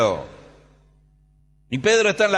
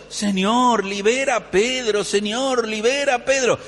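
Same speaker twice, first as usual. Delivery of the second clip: high-pitched acted supplication; imploring prayer; 7 semitones higher than usual. Church praying for jailed Peter, imploring. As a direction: plead earnestly in a repeated chant-like prayer. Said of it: "la gente está orando, diciendo".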